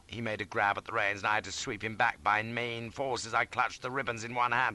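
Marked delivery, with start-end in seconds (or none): none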